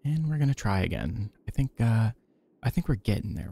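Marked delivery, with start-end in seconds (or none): none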